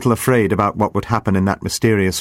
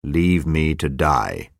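weary dying man; 6 semitones lower, slower than usual. Acted. Dying Albard's voice.

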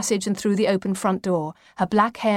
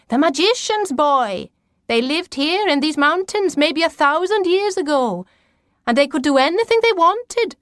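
high, intense storytelling as grandmother; mysterious grandmother; 8 semitones higher, 4 dB louder than usual. A narrator's reading. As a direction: tell of ancient wonders in a high, urgent voice.

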